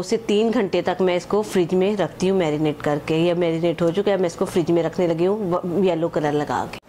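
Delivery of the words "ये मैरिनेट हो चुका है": ordinary talk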